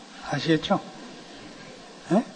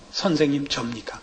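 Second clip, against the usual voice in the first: softer, lower question; John quietly asking Jesus; a touch hushed John; 6 semitones lower, 8 dB quieter than usual. Retold or imitated. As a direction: ask it quietly, almost whispered. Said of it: "요한이 나서서".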